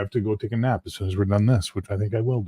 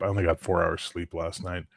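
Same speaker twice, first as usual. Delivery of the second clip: usual speaking voice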